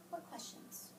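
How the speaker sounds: breathy soft questions; whisper. Real, not acted. Teacher whispers to class, asking for questions. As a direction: trail off into a whisper.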